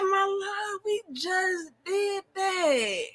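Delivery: high, drawn-out plaintive moan; mock whining. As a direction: whine it slowly, as if close to tears. Mock complaint quoting worn-out self to husband.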